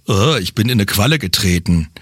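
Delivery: low, dismayed beachgoer; low man voice. Acted. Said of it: "stellen wir mal so eine typische Geräuschkulisse nach".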